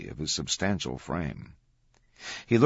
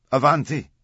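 loud, high call across a room; 8 semitones higher, 9 dB louder than usual. A narrator's reading. Man at desk waving visitors over, calling out. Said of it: "he called out again".

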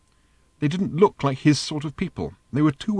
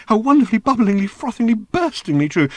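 high and louder, swelling with joy; rapture peaking; 11 semitones higher, 5 dB louder than usual. A narrator's reading. The robot swelling into louder ecstasy over Ford's remark. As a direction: burst into ecstasy word by word.

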